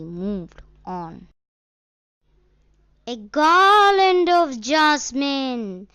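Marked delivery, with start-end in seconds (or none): 3.1-5.9 loud, high street-vendor call; seller's shout. A narrator's reading. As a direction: belt it out across the fair. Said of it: "seller shouted".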